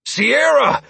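loud relieved call of her name; relieved exclamation. A narrator's reading. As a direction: cry out with relief. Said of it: "I exclaimed in relief".

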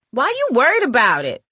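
put-on high, sassy girl retort; snotty tone. A narrator's reading. Girl answers nosy neighbour, snotty. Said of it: "she asked in a snotty tone".

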